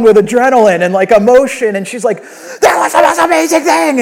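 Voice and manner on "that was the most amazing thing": put-on high, loud, overwhelmed child's shout; imitated excited yelling. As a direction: yell it like a kid flooded with adrenaline. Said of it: "she's, like, yelling".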